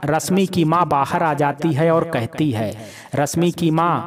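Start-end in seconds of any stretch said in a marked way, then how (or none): none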